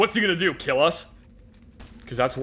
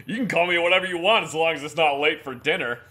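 ordinary talk

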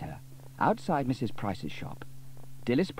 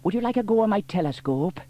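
put-on higher, louder sly boy; mischievous offer; 11 semitones higher, 6 dB louder than usual. A narrator's reading. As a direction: hide the trick. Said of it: "asked Norman mischievously".

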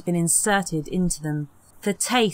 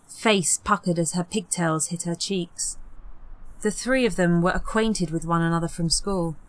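plain reading voice

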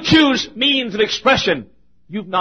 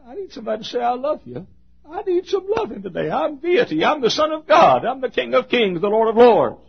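voicing Jesus; 6 semitones higher than usual. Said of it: "He said".

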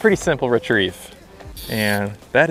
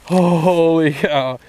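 amazed; 3 semitones higher, much slower than usual. Real, not acted. Big bass landed, drawn out.

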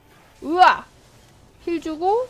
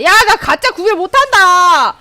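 loud, slow yell; panicked yell; 6 semitones higher, 10 dB louder, slower than usual. Real, not acted. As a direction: shout it out in panic. Panicking mid-fight. (당황한 외침)

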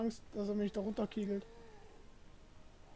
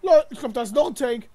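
loud alarm; 2 semitones higher, 14 dB louder than usual. Real, not acted. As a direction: shout out the second threat. Spotting a second tank.

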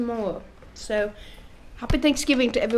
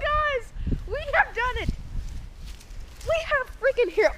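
much higher, triumphant cheer to the camera; excited; 7 dB quieter than usual. Real, not acted. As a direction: announce the win bursting with glee. Triumphant after a lucky shot.

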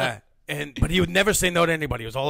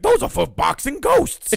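high, loud and slower, laughing as he goes; laughing cartoon voice; 11 semitones higher, 7 dB louder, slower than usual. The costumed performer tells the kids about his gloves, high and laughing. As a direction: play the cartoon hero for the kids.

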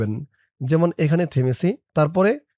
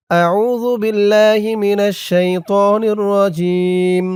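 slow, drawn-out Quranic chant; slow recitation; 4 semitones higher, 5 dB louder, much slower than usual. Shown as a demonstration. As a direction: recite it slowly with tajweed. Recites Arabic slowly. (ধীর তিলাওয়াতের সুরে)

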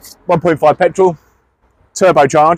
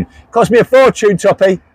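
higher-pitched call to someone further off; raised voice, aimed across the room; 5 semitones higher than usual. Real, not acted. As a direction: call it across so Toppy hears. Repeats it, calling out to Toppy.

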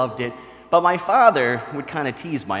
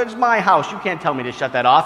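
defiant father; 5 semitones higher, 5 dB louder than usual. Retold or imitated. Father retorts to uncle.

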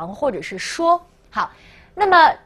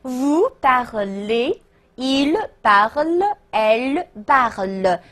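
French forms drawn out word by word; slow, carefully separated pronoun and verb; slow conjugation model; much slower than usual. Shown as a demonstration. Teacher modelling verb forms for students, slow and separated. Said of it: "我们说的时候叫做".

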